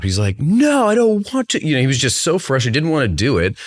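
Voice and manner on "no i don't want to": much higher, slower mock child's snap; a dad imitating his son snapping at bedtime; imitating angry son. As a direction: play the ten-year-old refusing in a huff. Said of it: "all of a sudden snapped".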